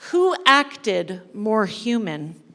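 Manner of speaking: plain reading voice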